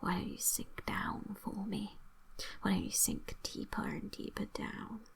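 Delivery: ordinary talk, nothing marked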